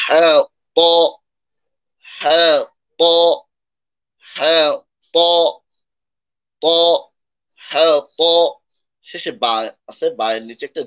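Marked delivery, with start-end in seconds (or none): 0.1-8.2 হ্যাঁ breathed "ha", then ত firm and heavy without air; heavy hard ta. Shown as a demonstration. Teacher demonstrates the firm letter, ত heavy after a drawn হ্যাঁ. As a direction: recite হ্যাঁ higher, then strike ত with raised tongue back. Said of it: "শক্ত করে উচ্চারণ করব".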